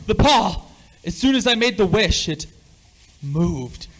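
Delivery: high, loud and shaken, words rushing out; frightened outburst. Acted. Father tells his wife the paw moved, loud frightened outburst. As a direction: blurt it out still in shock. Just felt the paw move.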